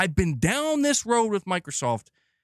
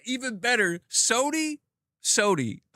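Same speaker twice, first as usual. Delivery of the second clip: higher, teasing sing-song call of Sony; mocking call-out; 7 semitones higher than usual. Real, not acted. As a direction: taunt Sony by name, calling out. Mock calling out to Sony.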